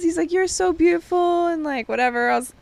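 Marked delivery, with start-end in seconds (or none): 0.3-1.5 mock suitor. Imitating the gym admirer's flirting.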